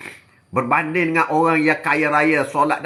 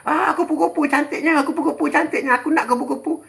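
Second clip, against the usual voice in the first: eager greedy wanting; 8 semitones higher than usual. Acted. Greedy person wanting butterfly.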